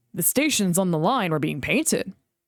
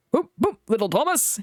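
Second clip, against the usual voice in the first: whistling engine; 4 semitones higher than usual. A narrator's reading. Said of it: "he whistled".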